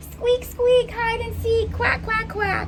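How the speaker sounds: high squeaky voice; squeaky mouse. Acted. Ducky plays hide and seek with mouse, squeaky and high. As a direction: squeak it out playfully, pitched high.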